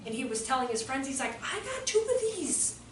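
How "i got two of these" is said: put-on puzzled child. Child telling friends, retold.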